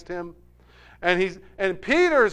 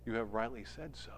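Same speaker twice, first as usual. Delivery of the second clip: much softer and lower, grave; hushed reply; 9 semitones lower, 17 dB quieter than usual. Retold or imitated. Jesus answering Judas at supper, hushed. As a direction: let it fall quietly.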